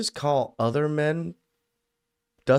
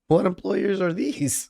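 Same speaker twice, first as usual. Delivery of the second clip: high-pitched disbelieving question; incredulous challenge; 7 semitones higher than usual. Real, not acted. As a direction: question it as if no such thing exists. Reactor challenges the woman's claim.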